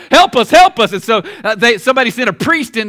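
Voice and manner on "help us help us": mock panicked plea. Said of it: "they were like".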